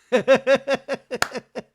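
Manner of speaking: loud high laugh bursting out; real burst of laughter. Real, not acted. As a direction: let a big laugh escape. Laughs after [name] quote.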